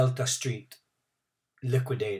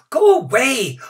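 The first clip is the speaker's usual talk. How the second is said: shouting; 13 semitones higher, 12 dB louder than usual. A narrator's reading. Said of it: "Many shouted at the old man".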